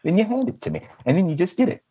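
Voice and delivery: put-on high, patronising explanation as if to a child; sarcastic step-by-step lecture to the waiter; mock explaining. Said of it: "I'm like".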